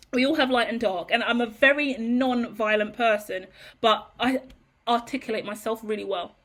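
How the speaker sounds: normal talking voice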